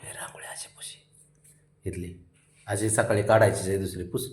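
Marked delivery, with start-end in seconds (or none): 0.0-1.0 unvoiced whisper aside; hushed whisper, wiping the rangoli. Real, not acted. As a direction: breathe it secretly, no voice.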